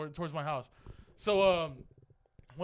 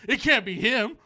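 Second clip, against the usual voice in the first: higher, louder accusing voice; mock suspicion; 5 semitones higher, 8 dB louder than usual. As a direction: imitate a jealous boyfriend working it out. Boyfriend's suspicion acted out.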